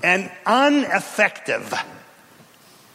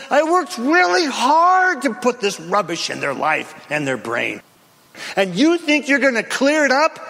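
a hint of a sneering devil, higher; devil's taunt; 6 semitones higher than usual. The devil mocking believers, light sneer. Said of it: "That's what the devil says".

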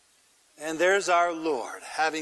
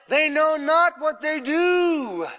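anguished cry; 8 semitones higher, 4 dB louder, slower than usual. Acted. Jesus cries out during nailing.

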